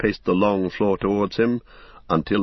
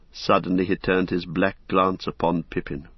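plain reading voice

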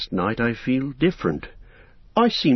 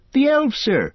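high, bright reply; eager; 3 dB louder than usual. A narrator's reading. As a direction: burst out with it eagerly. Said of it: "Sam answers".

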